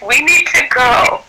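high, stretched-out urgent plea; retold alarm, pitch leaping up. Doula to mother already pushing, urgent.

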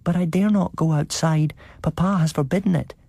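put-on high, small child's question. A narrator's reading. The child calling to his grandfather at night, high and small. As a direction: ask like a hopeful little boy.

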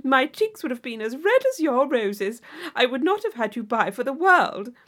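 gushing Isabella. Acted. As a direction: gush at her like an excitable young lady. Isabella chattering on.

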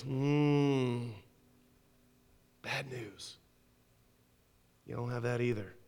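very soft, low, mock-sorry murmur; quiet dismissal. Secret police crushes the prisoner's last hope, soft low dismissal. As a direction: let him down gently and coldly.

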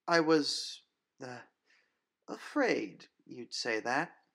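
put-on strained nervous girl. Grace answers the vampire, strained and afraid. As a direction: force the words through fear.

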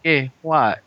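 put-on puzzled Mexican accent. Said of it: "interesting accent".

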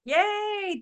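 high, bright squeal of yay; delighted host cheering; excited cheer. Real, not acted. Host cheers after speaker finishes.

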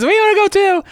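put-on very high, squeaky kid voice; goblin kids. Other goblin kids begging the leader, squeaky and eager. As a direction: whine it up high like eager children. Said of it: "And the other kids".

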